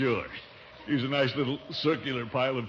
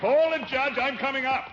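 high-pitched holler upward; urgent calling out; 11 semitones higher, 3 dB louder than usual. Acted. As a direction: shout up as you start to climb. Climbing, calls up to judge.

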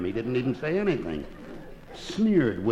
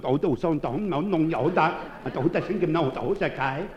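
higher-pitched mock Chinese gibberish; 6 semitones higher than usual. Man talking to his parrot, mock Chinese. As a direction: rattle off pretend Chinese in a higher voice. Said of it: "talking Chinese to him".

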